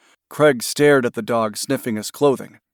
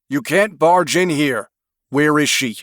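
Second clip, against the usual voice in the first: angry protest; 4 semitones higher, 4 dB louder than usual. Acted. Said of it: "Craig protested".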